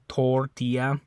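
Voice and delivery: "tortilla" with pure O and a Y sound for the double L; Spanish tortilla. Shown as a demonstration. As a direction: say "tortilla" the Spanish way, tor-TEE-ya. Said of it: "it's an O".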